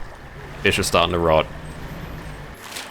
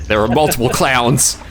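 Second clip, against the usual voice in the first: mock panic; 6 semitones higher, 3 dB louder than usual. Mock panic about clowns.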